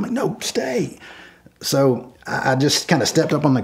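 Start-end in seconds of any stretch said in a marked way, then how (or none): 0.1-1.0 high, firm shouted command; shouting again at the girlfriend to stay; insistent yell. Retold or imitated. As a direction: order her to stay put. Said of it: "I yelled at her".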